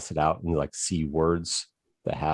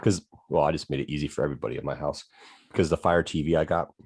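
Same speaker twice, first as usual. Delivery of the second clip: ordinary delivery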